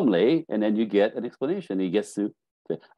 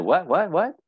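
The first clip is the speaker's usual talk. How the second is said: startled what; 8 semitones higher, 4 dB louder than usual. Retold or imitated. Partner caught off guard.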